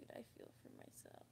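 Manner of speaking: breaking down to a whisper; choked whisper. Real, not acted. Pitying her past self while holding back tears, choked whisper. Tearing up retelling hug.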